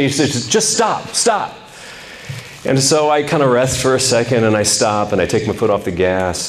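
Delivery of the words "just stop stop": mock yell. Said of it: "my grandfather yells at me".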